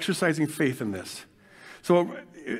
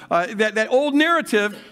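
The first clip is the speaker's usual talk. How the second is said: ordinary talk, nothing marked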